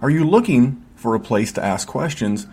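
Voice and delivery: upbeat promo voice-over read. Acted. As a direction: sell the Patreon like a radio spot. Podcast ad read over music.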